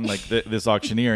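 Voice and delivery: usual speaking voice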